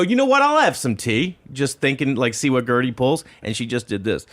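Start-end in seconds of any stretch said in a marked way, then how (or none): 0.0-1.4 much higher, teasing offer; high playful voice. Retold or imitated. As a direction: call her bluff brightly. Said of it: "And I go".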